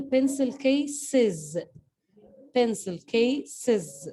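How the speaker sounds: "pencil cases" said slowly with a clear ɪz ending; slow model reading. Shown as a demonstration. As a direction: spell it out slowly for the class. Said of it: "the E S ... pronounced like this".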